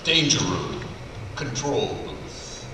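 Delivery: normal talking voice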